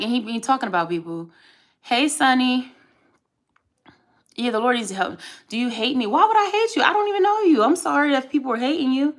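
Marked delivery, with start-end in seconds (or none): 6.1-9.1 high, loud, baffled protest; incredulous. Real, not acted. Answering a viewer who feels hated, incredulous. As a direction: protest it loudly, voice pitched way up.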